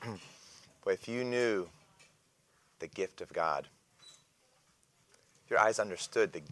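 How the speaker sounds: Jesus quietly coaxing the woman toward living water; tender invitation. Retold or imitated. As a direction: speak gently, almost hushed, like a secret offer. Jesus gently answers the woman.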